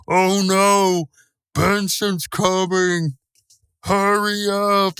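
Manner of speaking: high, very slow mock panic with stretched vowels; slow-motion voice. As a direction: drag each word out as if in slow motion. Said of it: "there's, like, this slow motion thing".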